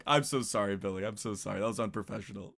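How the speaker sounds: low, soft embarrassed apology; sheepish apology. Real, not acted. Host apologizing to Billy, low and soft. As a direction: murmur it, embarrassed. Apologizing to guest after chaos.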